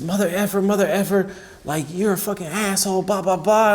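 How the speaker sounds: imitating cursing heckler. Bench player cussing out the pitcher, mock taunting. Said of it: "cursing at me".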